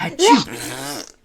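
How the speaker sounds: big slow achoo; loud fake sneeze. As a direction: blast out the sneeze. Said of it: "small and quiet one".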